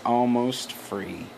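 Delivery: soft, slow, drawn-out mock last breath; hushed, slow imitation of someone fading away; imitated dying words. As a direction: say it like a dying man, slowly and quietly. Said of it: "looks at him as he's dying".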